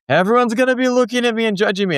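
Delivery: put-on high, rushed child's protest; worried kid impression, high and racing; imitating worried daughter. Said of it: "and she was like".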